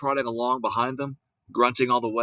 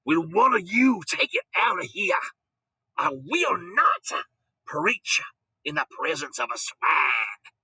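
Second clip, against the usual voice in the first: indignant preacher; 5 semitones higher, 4 dB louder, slower than usual. Acted.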